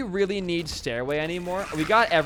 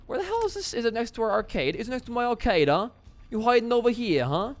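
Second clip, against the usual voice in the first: high-pitched, badly faked British accent; awful British accent; 8 semitones higher than usual. Gamer hunting for the arcade, bad mock British accent. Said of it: "That was an awful accent".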